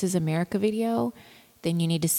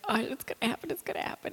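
mock dread; 6 semitones higher, 5 dB quieter, much faster than usual. Viewer dreading cringe scene.

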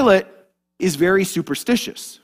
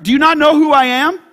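high, loud, rising challenge; indignant rank-pulling; 7 semitones higher, 5 dB louder than usual. Acted. Pilate challenging Jesus, loudly indignant. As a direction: throw it out as an outraged question.

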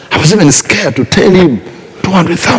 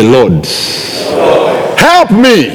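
prayer; 9 semitones higher, slower than usual. Acted. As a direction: pray it out, climbing in fervour. Leads congregation in prayer.

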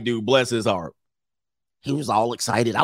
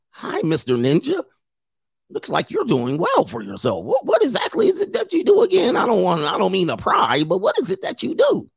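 high, prim mock neighbor voice; hammed-up suburban wife, falsetto; 7 semitones higher than usual. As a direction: squeak out the prying question in a put-on falsetto.

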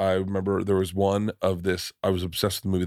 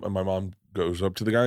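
normal talking voice

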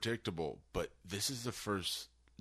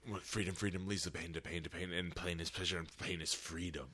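low Joker quote; 4 semitones lower, 8 dB quieter than usual. Retold or imitated. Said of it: "it was like".

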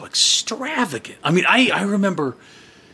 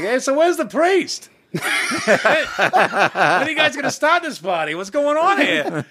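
brash New York wedding guest asking for the priest; New York accent; 8 semitones higher, slower than usual. Retold or imitated. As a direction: ham up a brash New Yorker. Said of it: "'cause he was talking like this".

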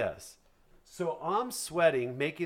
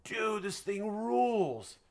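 drawn-out, higher-pitched awe; retold awed delight; 5 semitones higher, much slower than usual. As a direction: stretch every word in mock-amazed admiration. Acting out his delighted reaction.

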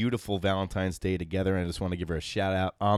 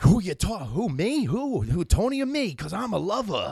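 high, singsong mock eagerness; eager self-styled lover; 10 semitones higher, 3 dB louder than usual. Host acting out his co-hosts answering "lover boy", high and eager. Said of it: "both of you guys should have been like".

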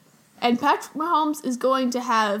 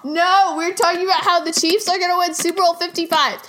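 insistent; 5 semitones higher, 7 dB louder than usual. Real, not acted. Cutting off mom's tangent, insisting.

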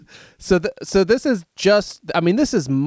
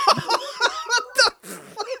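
laughing disbelief; 4 dB quieter, much slower than usual. Real, not acted.

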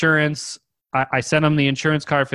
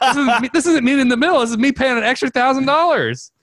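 high, sputtering retold indignation; outraged disbelief; 10 semitones higher than usual. Buyer argues against paying extra thousand, outraged. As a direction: protest it hotly, pitched way up.

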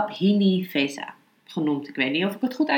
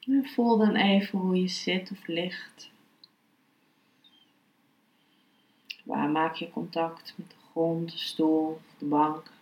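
guided meditation; 3 dB quieter than usual. Acted. (rustige, zachte meditatiestem)

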